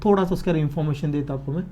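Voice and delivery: usual speaking voice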